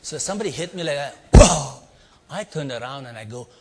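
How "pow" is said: a sudden loud impact noise; the punch landing, shouted out; loud imitated punch. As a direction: shout "pow" like a comic-book punch. Describing the hit.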